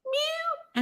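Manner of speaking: very high, soft little mews; kitten meowing. Retold or imitated. Retelling how the kitten went, little meows. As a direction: imitate a tiny kitten crying out. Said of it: "it was going".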